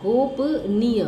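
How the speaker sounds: गोपनीय said slowly, long final ई drawn out; teacher slowly sounds out गोपनीय with long ई; slow correct form. Shown as a demonstration. Teacher to students, slowly stretching the long vowel. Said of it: "ये शुद्ध है".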